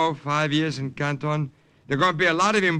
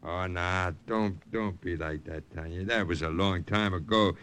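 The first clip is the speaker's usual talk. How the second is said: low, soft placating; smooth it over gently; quiet; 9 semitones lower, 7 dB quieter than usual. Acted. Brother backs down, soothing.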